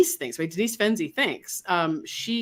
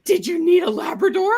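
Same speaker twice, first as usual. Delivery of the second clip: eager dog voice; 9 semitones higher, 5 dB louder than usual. Retold or imitated. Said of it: "they're like".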